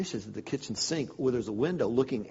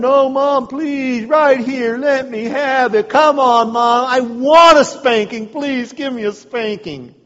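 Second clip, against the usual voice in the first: high, loud, mocking plea for a spanking; taunting dare; 6 semitones higher, 9 dB louder, slower than usual. Boy loudly taunting his mom to spank him. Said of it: "So I said".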